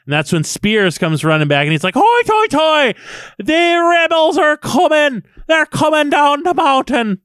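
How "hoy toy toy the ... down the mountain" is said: panicked alarm. Retold or imitated. Sergeant running back alarmed.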